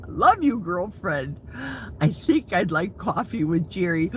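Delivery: ordinary talk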